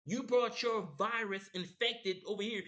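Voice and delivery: high, loud accusing outburst; angry rant. Retold or imitated. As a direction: blast him for what he did. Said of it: "I'm just like".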